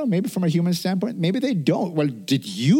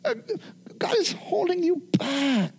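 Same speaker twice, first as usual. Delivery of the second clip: whining devil voice; 12 semitones higher, 4 dB quieter than usual. Retold or imitated. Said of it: "he wants you to think".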